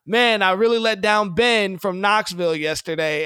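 mock a quarterback moaning about a fan; mock player lament. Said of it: "Joe Milton is not in his dorm room like".

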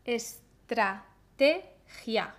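"Estrategia" very slowly drawn out, each syllable stretched; slow demo word. Shown as a demonstration. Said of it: "Vamos a ver cuántas sílabas tiene estrategia".

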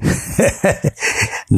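soft breathy burst of laughter; helpless laughter. Acted. Wife collapses laughing at husband's fight, breathy laugh. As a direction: laugh you can't hold in. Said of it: "నవ్వు ఆపుకోలేక".

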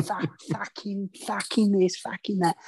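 hammed-up Cockney tough guy; Cockney accent. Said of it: "they don't speak like".

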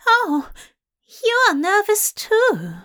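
high, bright with delight; thrilled discovery. Acted.

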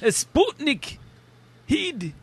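very high comic Scottish voice; mock Scottish accent. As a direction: squeak it out in silly Scottish. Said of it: "it's supposed to be Scottish".

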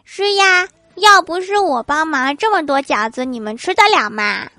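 put-on high, loud child's comeback at the start, easing off; burping child. Child answering dad's teasing about helping with dumplings, mock high-pitched retort. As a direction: pipe up as a cheeky well-fed kid. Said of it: "打着饱嗝说".

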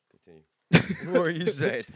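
pompous prompt to go on; mock formal voice. Put-on voice after joke.

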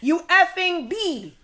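loud, high, slow insult; mimicked angry heckler. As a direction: hurl it like an outraged man in the crowd. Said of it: "who was right in the crowd goes".

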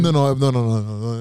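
ordinary delivery

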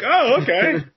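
high, laughing mock pleasantness; big-smile delight. Man reacting to a heckler under his mask, gleeful. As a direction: pitch it up through a big smile. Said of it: "very, very, very big smile".

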